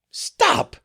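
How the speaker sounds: very high shrieking stop; raging cry. A narrator's reading. Madam cuts the cook off with a furious shriek. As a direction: shriek it in fury. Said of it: "cried madam in a rage".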